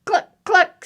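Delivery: high, loud clucks; chicken clucking. Acted. Chicken answers duck riding past, high clucking. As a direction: cluck sharply like a hen.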